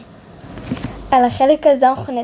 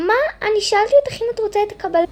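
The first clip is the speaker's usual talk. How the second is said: exasperated protest; 8 semitones higher, much faster than usual. Acted. Cashier defends herself.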